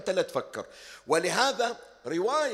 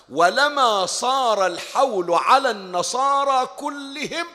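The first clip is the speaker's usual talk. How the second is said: loud, slow, higher climax; full-force preaching; 7 semitones higher, 7 dB louder, slower than usual. Acted. Preacher tells the fate of Najran's Christians, loud and slow.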